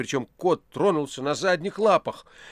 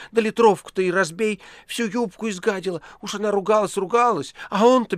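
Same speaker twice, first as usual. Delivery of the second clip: high, loud gossiping woman's cry; shrill shouting townswoman; 9 semitones higher, 5 dB louder than usual. A narrator's reading. Woman yelling the gossip at her neighbour, high and loud. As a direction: shout the news into his ear like a market woman. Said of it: "закричала над самым ухом поэта".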